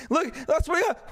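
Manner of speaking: normal talking voice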